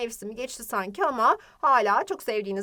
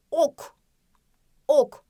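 "Ok", paused, then louder "ok"; stress on initial O; 3 semitones higher, 4 dB louder than usual. Shown as a demonstration. Teacher drilling pupils, firm stress on the O of "Ok". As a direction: punch the O at the start of each "Ok". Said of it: "O sesini başta vurgulayarak".

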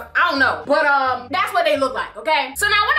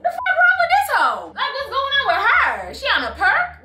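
much higher, stunned and appalled; baffled outburst; 9 semitones higher than usual. Retold or imitated. Narrator reliving the girl attacking her, high and baffled. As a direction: react like you can't believe what you're seeing. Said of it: "And I'm like".

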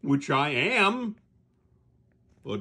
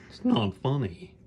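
ordinary talk, nothing marked

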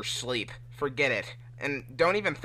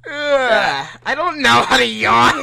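high, loud, laughing whine; 9 semitones higher, 14 dB louder, slower than usual. Real, not acted. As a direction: laugh through it helplessly. Laughing while trying to yawn.

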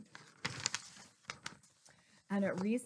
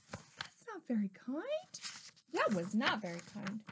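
ordinary delivery, nothing marked

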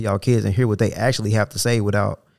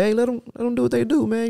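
higher, easygoing imitation of dad; pastor dad; 12 semitones higher than usual. Pastor dad at church telling folks to let kids run, easygoing. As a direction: lightly voice the relaxed pastor dad. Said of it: "He be like".

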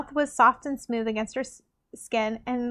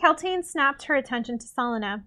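ordinary delivery, nothing marked

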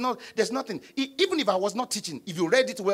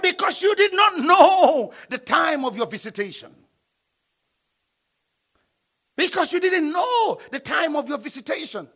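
raised, loud preaching voice; emphatic scripture reading; 7 semitones higher, 7 dB louder than usual. Real, not acted. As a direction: proclaim the verse to the congregation, high and forceful. Reads the verse out emphatically.

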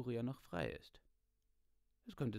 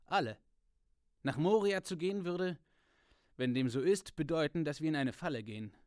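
louder, urgent warrior warning; proud soldier arguing louder than the rest; Boromir, forceful; 3 semitones higher, 8 dB louder than usual. A narrator's reading. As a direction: press the warning hard and loud. Boromir voiced in read story.

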